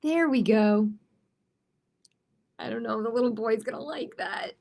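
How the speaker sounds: lively picture book read-aloud; story narration. Acted. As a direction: read it to the kids with playful energy.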